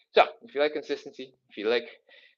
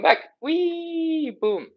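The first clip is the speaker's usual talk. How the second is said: high, slow sing-song wee; playful wee; 6 dB louder, much slower than usual. Real, not acted. Coach cheering herself back up, high and slow. Playful cue during stretch.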